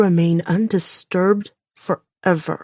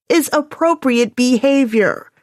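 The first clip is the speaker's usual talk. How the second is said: dripping sarcasm; 6 semitones higher, 5 dB louder than usual. A narrator's reading. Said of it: "let the sarcasm drip".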